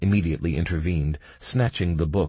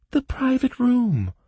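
hurt; 3 dB louder than usual. A narrator's reading. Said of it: "the girl said, hurt".

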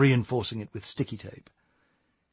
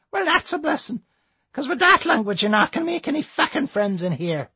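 put-on old Irish lady voice; 3 dB louder than usual. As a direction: scold like a cross granny.

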